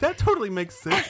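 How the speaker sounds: high, loud gullible agreement; mock naive. Teasing co-host for buying the movie's logic, high mock-naive voice. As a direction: chirp it like an easily convinced fan. Voicing co-host's naive younger self.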